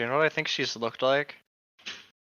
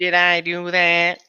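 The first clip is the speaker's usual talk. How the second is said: slow, louder nasal-free mock whine; Squidward impression; 4 semitones higher, 8 dB louder, slower than usual. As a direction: do a Squidward line.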